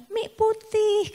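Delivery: put-on high, slow little child's voice; toddler voice. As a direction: imitate the toddler asking for plain water. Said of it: "dia pagi dia bilang".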